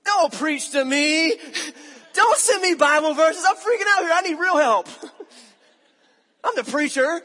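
hammed-up protest at being preached to; loud hammed-up indignant whine; exasperated outburst. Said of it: "my first reaction was like".

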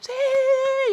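very high, loud cheering yell; imagined crowd cheer. Retold or imitated. Everyone in line applauding the yell, loud high cheer. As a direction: cheer like a whole crowd joining in. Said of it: "todo el mundo iba a decir".

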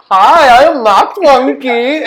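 high, slow, hammed-up German accent; mock German accent. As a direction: play a silly German sock puppet in broken English. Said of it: "talk to it in German".

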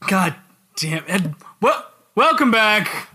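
normal talking voice

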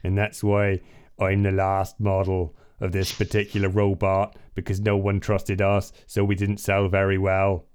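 West Country robot with pirate vowels; piratical West Country robot. Acted. Failed robot model explaining itself, West Country pirate accent. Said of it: "they sound like pirates".

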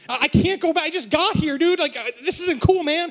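high, indignant pleading; pleading protest. Retold or imitated. Student protesting to security guards about deportation, pleading.

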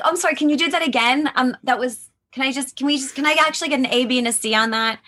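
high, bright mock director asking for retakes. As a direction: play a chirpy voice director. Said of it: "Just to be like".

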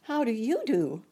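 put-on courteous high child voice; Dorothy. A narrator's reading. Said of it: "replied Dorothy politely".

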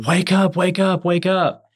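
high, urgent calls to wake her; frantic, trying to rouse her; husband begging his unconscious wife to wake, frantic high pleas; desperate pleading. Retold or imitated. Husband shaking his overdosed wife.

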